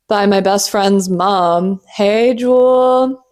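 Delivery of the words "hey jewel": slow, higher sing-song hail; called greeting. Acted. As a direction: call her name out playfully. Calls out to friend's mom.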